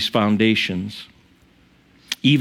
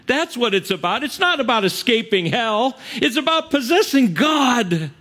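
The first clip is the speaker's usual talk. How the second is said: high, emphatic pulpit declamation; impassioned sermon, building in pitch; fervent preaching; 7 semitones higher than usual. Real, not acted. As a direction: proclaim it with rising fervour. Preaches a quotation with fervour.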